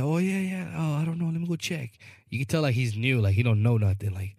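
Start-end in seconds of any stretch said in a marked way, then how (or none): 0.0-1.9 higher, hesitant clerk voice, a bit softer at the end; clueless new clerk. Retold or imitated. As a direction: play the unsure new guy, pitched up. New clerk Alan voiced, unsure.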